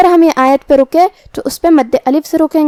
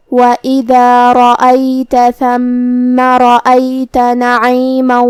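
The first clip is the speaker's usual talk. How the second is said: slow, drawn-out tajweed recitation; Quran recitation; much slower than usual. Acted. Teacher recites the verse for students, slow and melodic. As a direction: chant each word slowly and melodically.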